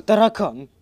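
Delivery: sudden loud gasp, then shout; startled gasp and shout. Real, not acted. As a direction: jump in fright and yell. Startled by cockroach.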